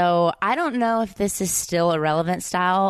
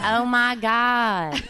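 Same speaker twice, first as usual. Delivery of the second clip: put-on very slow, high Valley Girl drawl; Valley Girl accent; 5 semitones higher, much slower than usual. Shown as a demonstration. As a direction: stretch it out Southern Valley Girl style. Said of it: "Southern accent mixed with a Valley Girl accent".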